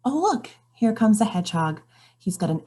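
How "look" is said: ordinary delivery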